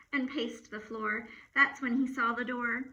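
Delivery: plain reading voice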